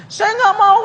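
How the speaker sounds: high falsetto woman saying no; refusing woman's voice. Acted. Woman refusing to leave her town, high falsetto. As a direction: squeak the refusal like a pouty girl.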